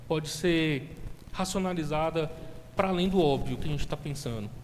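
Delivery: loud, impassioned sermon delivery; preaching. Acted. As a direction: preach it out with fervor. Preaching builds to full force.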